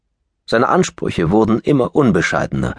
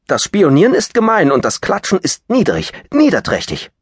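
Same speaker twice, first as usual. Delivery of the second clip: much higher and louder; indignant outburst; 11 semitones higher, 4 dB louder than usual. Acted. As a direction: scold him in outrage. Lapkin indignant at blackmail.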